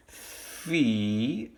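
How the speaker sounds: "Fee" stretched with a long E; first syllable alone. Shown as a demonstration. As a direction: hold "Fee" as a separate syllable. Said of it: "end of that first syllable".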